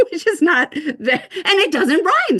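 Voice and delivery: high, loud, laughing through the words. Real, not acted. Teacher laughing at her own verse that doesn't rhyme, laughing. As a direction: crack up at your own botched example.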